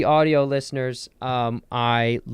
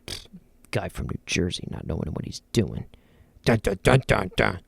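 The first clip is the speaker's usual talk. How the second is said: mock Pennsylvania guy; 4 semitones lower, 4 dB quieter than usual. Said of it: "a Pennsylvania guy was gonna be like".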